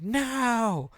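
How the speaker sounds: put-on high, flat refusal like a stubborn little girl; defiant toddler. Dad imitating his daughter refusing to say sorry, high and defiant. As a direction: squeak out a toddler's no. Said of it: "And she's like".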